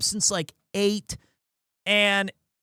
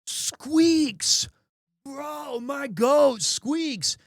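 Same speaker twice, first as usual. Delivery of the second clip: hyped fan voice; 6 semitones higher, much slower than usual. Retold or imitated. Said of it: "this one guy was like".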